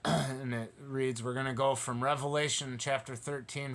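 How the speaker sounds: normal talking voice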